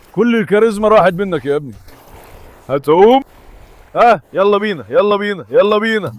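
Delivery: normal talking voice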